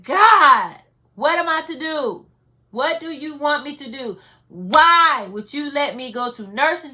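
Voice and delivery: loud, high cry pleading with God; anguished yelling. Retold or imitated. Mother on her knees questioning God, anguished yell. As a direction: wail each question upward at full voice. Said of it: "and I yelled. And I said".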